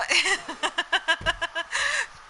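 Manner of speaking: bright burst of laughter, high; real laugh. Real, not acted. Laughs at her own story.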